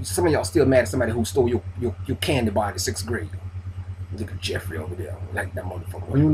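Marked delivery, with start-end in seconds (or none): 4.2-6.1 low, soft, sulky grumble about Jeffrey; mock bitter mutter, softer and lower; imitating a grudge-holder. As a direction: mutter it like a petty old grudge. Mocking a grudge-holding friend.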